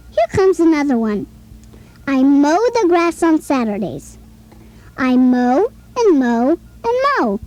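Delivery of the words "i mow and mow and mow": each "mow" leaned on, its long O drawn out; sing-song teacher emphasis on "mow"; O sound stressed. Shown as a demonstration. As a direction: repeat "mow" so the O vowel rings out. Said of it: "Which word has the O sound".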